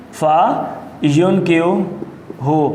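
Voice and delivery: فینکیوہ drawn out piece by piece with long pauses; slow dictation. Shown as a demonstration. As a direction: dictate فینکیوہ very slowly, in separate parts. Teacher dictates the word piece by piece.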